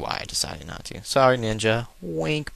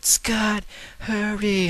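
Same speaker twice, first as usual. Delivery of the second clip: mock yell; 12 semitones higher, much slower than usual. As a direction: yell the name like a panicked friend. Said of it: "Beast was running around yelling".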